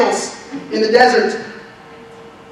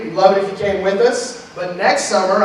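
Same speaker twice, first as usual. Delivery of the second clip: ordinary delivery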